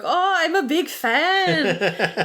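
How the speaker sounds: high, slow put-on gushing fan voice. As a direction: coo it like a starstruck fan. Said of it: "he's like".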